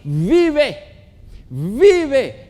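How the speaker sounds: loud, high preacher's shout; crying-out voice. Acted. God's voice calling the dead to live, commanding shout. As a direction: call the dead back to life. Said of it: "hay una voz que grita para ti".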